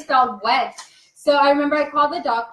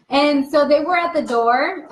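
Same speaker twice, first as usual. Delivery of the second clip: usual speaking voice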